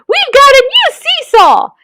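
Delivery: put-on high, thrilled child voices; excited children. A narrator's reading. Children at the playground: high excited voices. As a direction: delight at a new toy. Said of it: "they cried".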